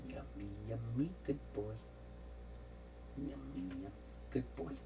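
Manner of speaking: talking to a cat. Real, not acted. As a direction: coo gently at the cat. Cooing to the cat.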